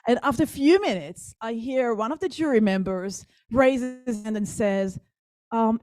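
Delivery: ordinary delivery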